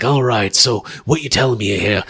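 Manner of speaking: low, quiet British tough guy; Shaw. Acted. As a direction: drop it low and keep it soft. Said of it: "What does Shaw sound like?".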